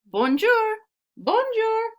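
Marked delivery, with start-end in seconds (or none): 0.0-0.8 bright, high, singsong shop greeting; lilting French shopkeeper hello. Acted. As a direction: greet the girl cheerfully in a lilting voice.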